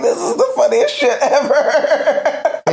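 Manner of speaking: retold line through real laughter; laughing. Retells laughing at the scene.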